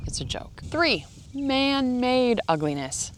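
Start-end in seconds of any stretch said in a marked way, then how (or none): 0.1-0.6 a quick unvoiced whisper of an aside; a soft whisper after the punchline. Real, not acted. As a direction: drop to a whisper, as if only to yourself. Whispered aside after her own joke.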